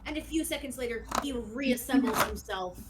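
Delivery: ordinary delivery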